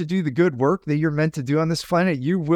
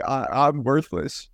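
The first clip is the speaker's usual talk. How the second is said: mock defeated self; 3 semitones lower, slower than usual. Acting out his sleep-deprived self.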